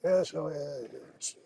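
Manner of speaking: mock indifference. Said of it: "the way you talk about it is like".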